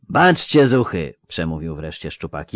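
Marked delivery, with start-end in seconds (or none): 0.1-1.1 mocking older soldier, drawn out; slow lisping veteran. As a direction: lisp it slowly with sly irony. Szczupak lectures company.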